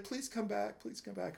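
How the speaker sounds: high, hushed imitation of a plea; soft retold pleading. Waitress pleading, voiced in the story.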